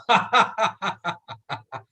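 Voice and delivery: hearty demonstration laugh; demo laugh. Shown as a demonstration. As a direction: belly laugh on cue. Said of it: "laugh it off".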